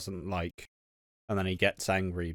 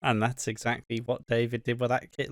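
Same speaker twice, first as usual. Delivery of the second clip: ordinary delivery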